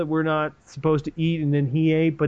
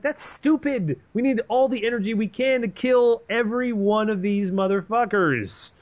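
scornful Jonathan; 7 semitones higher than usual. Retold or imitated. Jonathan mocks soldiers' fear.

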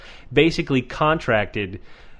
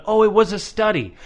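higher-pitched sarcastic echo; dismissive, pitched-up mimicry; mocking; 7 semitones higher than usual. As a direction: parrot it back in mock awe. Mocks the deniers' excuse.

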